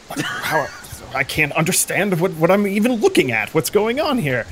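puzzled character muttering to himself; confused muttering. Retold or imitated. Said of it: "sitting there talking to yourself".

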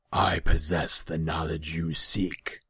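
slow, loud feline demon; rumbling growl. A narrator's reading. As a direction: rumble it deliberately. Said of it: "His voice was a rumbling growl".

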